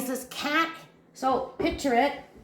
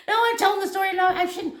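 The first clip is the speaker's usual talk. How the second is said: spooked; 8 semitones higher, 4 dB louder, faster than usual. Real, not acted. Spooked herself while telling story.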